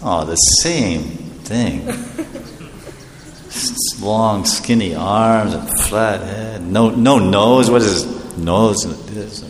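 low, slow, deflated muttering; disappointed devotee. Acted. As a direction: let the hope drain away, slowly and softly. Devotee sees wrong deity, let down.

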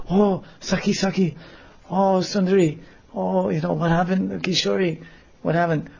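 put-on high, anxious girl voices; high fretting gopi voices; worried friends. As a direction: rush in asking what happened. Friends run over worried.

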